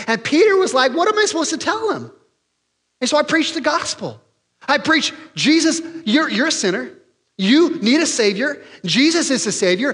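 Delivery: high, animated storyteller voice; lively Peter retelling. Acted. Peter telling the church his story, high and excited. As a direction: recount it eagerly as Peter. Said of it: "Peter tells the church".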